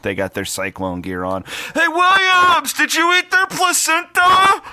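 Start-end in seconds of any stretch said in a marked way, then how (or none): 1.7-4.6 loud, high, slow holler; mock heckler's yell. Host imitating a listener yelling at him among his kids, loud high holler. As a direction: bellow like a stranger heckling in public.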